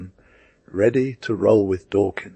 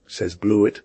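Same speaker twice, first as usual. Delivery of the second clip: ordinary delivery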